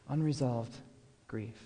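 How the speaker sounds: a hushed repeat of "Unresolved grief", dying off on grief; soft echo. Shown as a demonstration. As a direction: echo "Unresolved grief" quietly to let it sink in.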